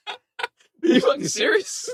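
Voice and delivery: high, slow, laughing disbelief. Real, not acted. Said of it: "laughing disbelief".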